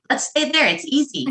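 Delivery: high, sing-song self-mockery; mock complacent. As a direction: tease your old lazy self. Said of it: "my pattern was".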